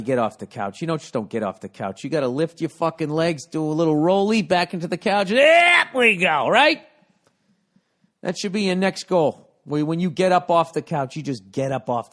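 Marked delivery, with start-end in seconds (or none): 5.3-6.9 high, loud, slow strained groan; hammed-up effortful grunt of getting up; straining effort. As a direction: push the words out like heaving off a couch. Acting out getting off couch.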